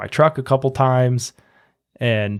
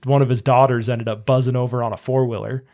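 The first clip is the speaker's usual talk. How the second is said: usual speaking voice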